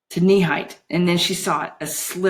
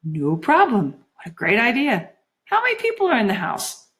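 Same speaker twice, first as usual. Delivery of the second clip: mesmerised Juliet, agreeable; 5 semitones higher than usual. Acted. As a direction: go along happily as if entranced.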